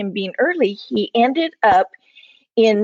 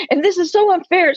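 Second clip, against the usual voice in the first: upset; 7 semitones higher, 4 dB louder than usual. Real, not acted. Upset about friend's miscarriages.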